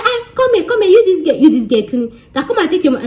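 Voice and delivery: high, loud calling back; mock shout. Landlady calling the tenant back, high mock shout. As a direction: yell after the girl to come back.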